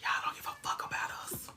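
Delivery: whispered complaint. Shown as a demonstration. Whispering her complaint to the chat, repeating herself. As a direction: hiss it under the breath to the viewers. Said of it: "but I'm like".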